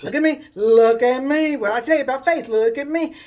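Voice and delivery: loud, urgent coaxing; forceful, commanding encouragement; insistent command. Acted. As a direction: call out firmly to hold his attention. Jesus coaxes shaking Peter across.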